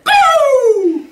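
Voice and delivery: very high, booming vocal explosion; full-volume drawn-out sound effect; long loud imitated blast. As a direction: let out a piercing cartoon boom. Imitates blast heard in the booth.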